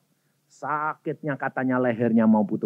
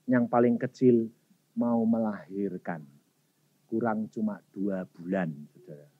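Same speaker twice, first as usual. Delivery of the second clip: normal talking voice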